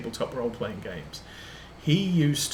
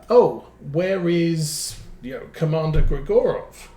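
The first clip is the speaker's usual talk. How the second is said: mock eager players calling for their favourite; 5 semitones higher, 8 dB louder than usual. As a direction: stretch it out, loud and keen. Players ask for the usual Russian contact.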